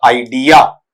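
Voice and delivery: "आइडिया" ending in a long yaa; common mispronunciation. Shown as a demonstration. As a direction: say "आइडिया" with a full final aa. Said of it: "कौन सा पढ़ देते हैं".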